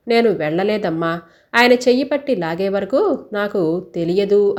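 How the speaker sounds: plain reading voice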